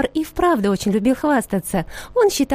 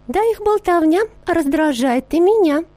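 higher, sweet sugar-bowl voice; sugary voice; 6 semitones higher than usual. A narrator's reading. Sugar bowl answers the creamer in a sugary voice. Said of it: "ответила сахарница сладеньким голосом".

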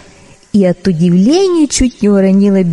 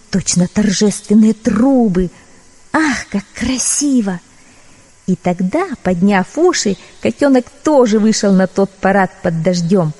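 ordinary delivery, nothing marked

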